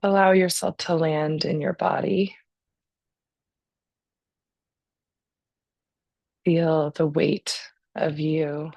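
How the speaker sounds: calm, unhurried breathing guidance; guided meditation. Real, not acted.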